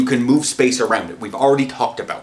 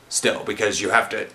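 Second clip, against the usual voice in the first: normal talking voice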